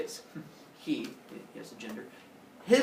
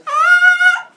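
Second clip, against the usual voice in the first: very high, loud drawn-out scream; short-lived comic shriek, held loud; mock death scream; 18 dB louder than usual. Semele sees Zeus's true form, mock scream.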